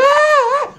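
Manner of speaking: high, loud horrified shriek; wife's scream. Acted. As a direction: shriek at the sight of a monster. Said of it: "and his wife's going".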